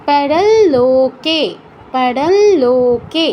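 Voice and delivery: "পাড়াল লোকে" slowly, with a long nasal ল joining the words; slow nasalized demo. Shown as a demonstration. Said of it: "নাসিকার কিন্তু অবশ্যই প্রয়োগ করতে হবে". ("পাড়াল লোকে", ধীরে, ল নাসিক্য করে টেনে)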